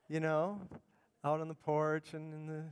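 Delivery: ordinary talk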